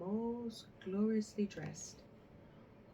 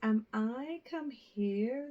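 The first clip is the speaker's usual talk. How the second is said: slow, louder, scornful drawl; proud and saucy; 4 semitones higher, 7 dB louder, slower than usual. A narrator's reading. A rude girl scorning the lady who asks for water, slow proud sneer. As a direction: look down your nose at the stranger. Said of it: "said the proud, saucy girl".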